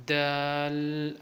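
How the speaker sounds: slow دال with a held final l; slightly stretched. Shown as a demonstration. Teacher showing how to stretch it, long دال. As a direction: draw out دال, "daaalll". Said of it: "تھوڑا کھینچ کے پڑھنا ہے".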